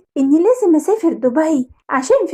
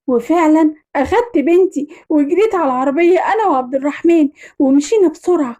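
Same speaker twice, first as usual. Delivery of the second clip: ordinary delivery, nothing marked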